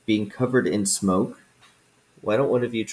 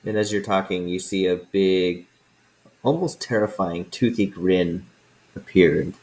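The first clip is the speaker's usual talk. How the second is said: normal talking voice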